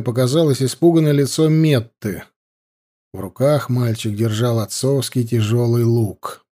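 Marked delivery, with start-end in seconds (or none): none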